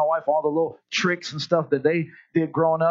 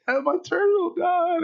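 high, slow mock sob; crying boy; 10 semitones higher, slower than usual. Little boy tells his dad his turtle died, in mock crying. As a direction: whimper it like a heartbroken little boy. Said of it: "He was like".

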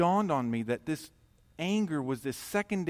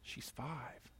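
hushed, much softer reply; soft inner voice; 16 dB quieter than usual. Retold or imitated. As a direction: answer gently from within. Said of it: "And I hear back".

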